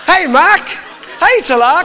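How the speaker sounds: high, loud teasing call; teasing shout. Retold or imitated. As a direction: shout it out to tease your mate. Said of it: "'cause they point at each other".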